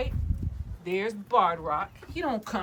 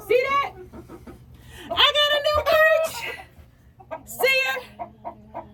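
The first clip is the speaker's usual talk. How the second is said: squeaky pet voice; 7 dB louder, slower than usual. Pretend. High chicken-talk voice.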